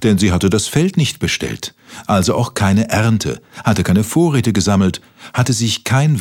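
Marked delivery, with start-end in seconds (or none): none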